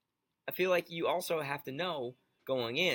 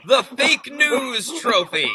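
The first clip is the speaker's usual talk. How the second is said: much higher, louder, drawn-out words; all-caps shout; 10 semitones higher, 14 dB louder, much slower than usual. Retold or imitated. Co-host reading the tweet's punchline, all-caps shout. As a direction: shout it like capital letters. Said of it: "All caps".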